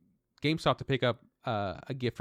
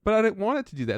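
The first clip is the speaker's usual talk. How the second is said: high, loud whining protest; mock Dave pleading he didn't mean it; whiny Dave; 9 semitones higher, 6 dB louder than usual. Imitating Dave whining he didn't want the card's effect. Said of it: "He's just like".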